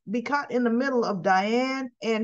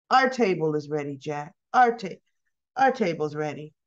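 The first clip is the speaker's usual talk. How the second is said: low-pitched, restrained reminder; Tracy, pointed; 7 semitones lower than usual. Retold or imitated. Tracy looking at Jack as the table waits, pointed. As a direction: act out a terse summons, pitched down.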